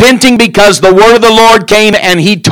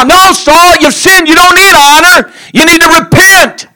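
much higher, scolding reply; rebuking Saul; 9 semitones higher than usual. Acted. Preacher answering Saul's plea, high scolding. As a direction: scold him back, up high and firm.

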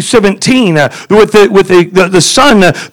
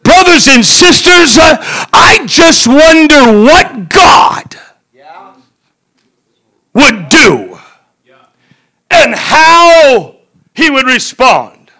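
fiery preaching; 5 semitones higher than usual. Real, not acted. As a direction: lift the church with joy. Preaching at full force.

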